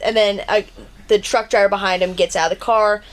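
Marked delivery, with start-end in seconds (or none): none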